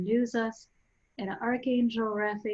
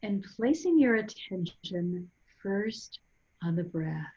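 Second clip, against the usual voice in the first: guided meditation. Real, not acted. Guide leading listeners to breathe and centre, calm guided meditation. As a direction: guide them gently inward, unhurried, phrase by phrase.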